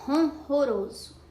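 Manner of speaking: "ronroroso" carefully, strong breathed first two Rs; demonstrated pronunciation. Shown as a demonstration. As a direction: say "ronroroso" with a light tapped last R. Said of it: "a escrita e a pronúncia da palavra". ("ronroroso" com R forte no começo e fraco no fim)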